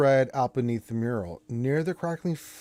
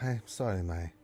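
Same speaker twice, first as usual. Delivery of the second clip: meek elf; 2 semitones lower, 8 dB quieter than usual. Acted. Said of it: "the elf's like".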